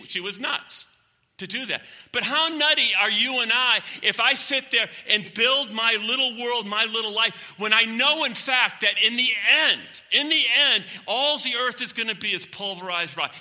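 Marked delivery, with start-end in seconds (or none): 2.1-13.3 loud, high-pitched pulpit exhortation; impassioned preaching. Real, not acted. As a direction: drive it home to the congregation, voice raised.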